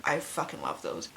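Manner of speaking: ordinary delivery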